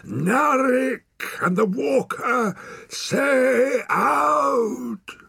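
high, loud, drawn-out roar; bellowed command. A narrator's reading. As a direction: bellow it like a wild hermit. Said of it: "he bellowed".

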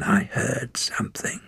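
sharp alarmed whisper; tense whisper. A narrator's reading. Woman whispering that she heard a noise, whispered. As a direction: whisper it, listening hard. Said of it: "said the woman".

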